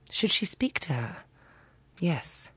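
ordinary delivery, nothing marked

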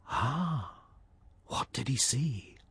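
hushed storyteller's whisper; whispered suspense. A narrator's reading. Narrator teasing listeners, hushed whisper. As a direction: drop to a whisper to build suspense.

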